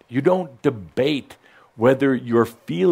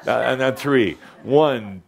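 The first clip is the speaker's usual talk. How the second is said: ordinary delivery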